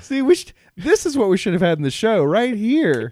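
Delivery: high, loud excited outburst; excited laughing. Real, not acted. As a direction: burst in delighted.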